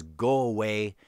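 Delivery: usual speaking voice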